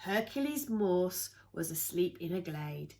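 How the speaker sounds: plain reading voice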